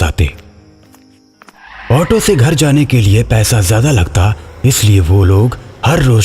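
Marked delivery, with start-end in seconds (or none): none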